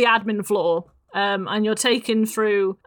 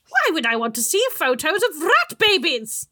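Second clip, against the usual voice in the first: witchy voice; 9 semitones higher, 3 dB louder than usual. Acted.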